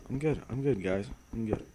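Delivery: ordinary talk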